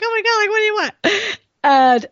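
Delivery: high, panicked mock cry of alarm; panicking at an old flame's message; freaking out. [name] retelling her panic at his message, high panicked cry. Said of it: "like, freaking out".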